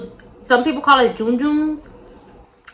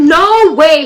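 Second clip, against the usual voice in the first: loud, higher imitation of shock; over-the-top disbelief; 6 semitones higher, 10 dB louder than usual. Extra best friend reacting to the kiss, loud disbelief. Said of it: "she was as extra as me".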